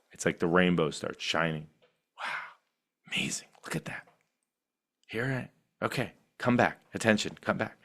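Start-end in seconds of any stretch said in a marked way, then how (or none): 2.2-7.8 much softer, hushed and gentle; hushed wonder. Pretend. Meditator quietly noticing the wandering and calling attention back. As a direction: softly call the attention home.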